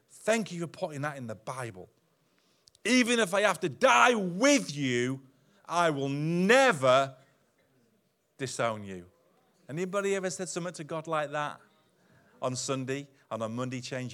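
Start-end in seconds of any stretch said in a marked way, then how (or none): 2.8-9.1 slow, loud, stressed vow; Peter's emphatic vow; emphatic insistence. Acted. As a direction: insist as if swearing an oath. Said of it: "Peter insisted emphatically".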